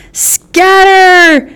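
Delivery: a very high, loud shout. Acted. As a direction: bark the order to run. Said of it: "Darius shouted".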